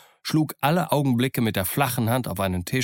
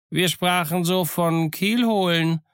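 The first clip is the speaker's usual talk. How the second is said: high, slow, hesitant sailor; slow high-pitched servile reply; evasive sailor; 8 semitones higher than usual. A narrator's reading. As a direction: drag out a reluctant answer, pitched high. Sailor reluctantly answers Silver.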